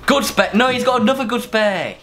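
high, wailing protest; exasperated gamer losing it; distressed outrage. Real, not acted. Watches opponent win the final pot.

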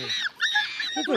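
hugely high, very slow drawn-out wail; scandalised cry. Acted. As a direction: stretch the word in outraged disbelief. Harry scandalised by the inspector. (grido scandalizzato, altissimo e lentissimo)

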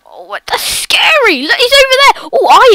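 high, loud frightened cry; crying out in fear; panicked. Real, not acted. Slender spotted again.

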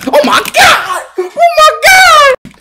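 high drawn-out outraged oh my God; stretched, high shout of disbelief; exasperated shout. Real, not acted. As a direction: wail it in disbelief. Exasperated at game call.

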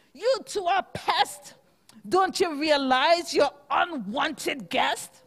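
mock bellow at the pests; rhino bellowing. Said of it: "The rhinoceros bellowed".

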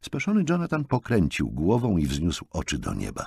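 usual speaking voice

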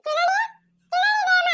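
very high, piercing little call; childish calling out. Acted. Little one calling after being hushed, high-pitched cry. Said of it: "ಸುಮ್ನೆ ಇರು, ಕೂಗ್ಬೇಡ".

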